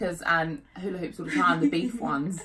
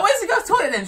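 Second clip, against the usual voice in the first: excited; 11 semitones higher, 4 dB louder than usual. Retold or imitated. Retold high question.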